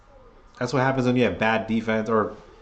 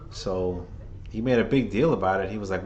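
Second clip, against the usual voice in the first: ordinary delivery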